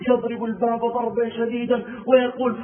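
ordinary talk

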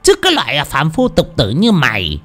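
high, pompous and condescending. Acted. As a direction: look down on him, puffed up. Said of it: "đoạn lên giọng". (lên giọng kẻ cả)

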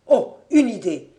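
loud excited exclamation; sudden idea. Acted. Fabien suddenly struck by an escape plan, excited cry. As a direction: burst out with the idea.

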